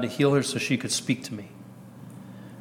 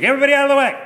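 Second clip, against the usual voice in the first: much higher, loud commanding cry; guardian angel clearing the road; 13 semitones higher, 7 dB louder, much faster than usual. Retold or imitated. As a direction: bellow at traffic to clear the way. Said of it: "that was my guardian angel".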